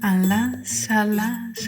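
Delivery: plain reading voice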